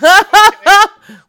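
high shrieking burst of laughter; loud laugh. Real, not acted. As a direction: crack up loudly. Reacting to dick slap joke.

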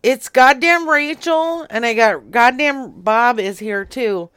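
loud, fed-up insistence; exasperated. Real, not acted. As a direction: push it through, annoyed. Exasperated at repeated "who is this.

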